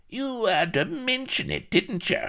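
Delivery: very high, squeaky dwarf voice; indignant dwarf. Acted. Dwarf pirate reproaches captain for mentioning it, indignant squeak. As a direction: scold him in a high little voice. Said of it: "on indignant peg leg".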